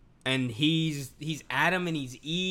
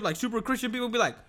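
ordinary talk

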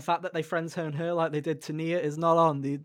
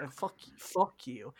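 normal talking voice

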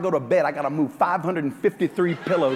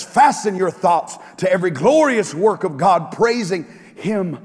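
raised, ringing sermon delivery; preaching; 7 semitones higher, 7 dB louder than usual. Acted. Pastor lifting the congregation, preaching full out. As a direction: preach it out to the church with force.

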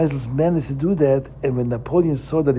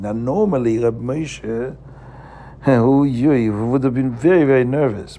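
plain reading voice

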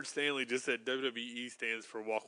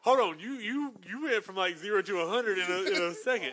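imitating Bobby; 6 semitones higher, 5 dB louder than usual. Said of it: "Bobby said".

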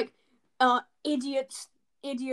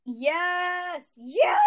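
excited cheer; 4 semitones higher, 6 dB louder, much slower than usual. Real, not acted. Cheering topic choice.

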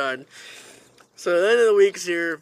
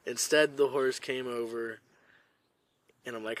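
ordinary talk, nothing marked

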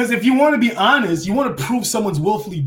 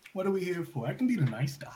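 much softer nice-guy demo; 12 dB quieter than usual. Shown as a demonstration. Wrestler showing he can play nice, soft demo voice. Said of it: "there's all different cadences and fluctuations".

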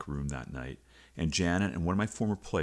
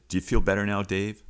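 retold dry sarcasm, a bit louder; sarcastic; 4 semitones higher, 6 dB louder than usual. Wife mocking Dave after his outburst, sarcastic. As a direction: needle him with a mock question.

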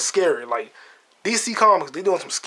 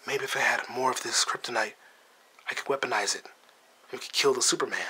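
lower, softer, scheming villain murmur; Lex Luthor impression; 4 semitones lower, 7 dB quieter than usual. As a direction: quietly plot like Lex Luthor. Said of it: "That's how Jesse Eisenberg was talking".

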